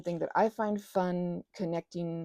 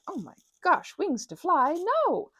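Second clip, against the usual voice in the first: high, loud, hammed-up outcry; mock dismay; 11 semitones higher, 5 dB louder than usual. Vlogger imagining a viewer objecting to an accent, mock dismay. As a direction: play a scandalised viewer, pitched up high.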